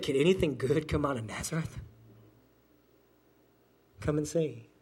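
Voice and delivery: ordinary talk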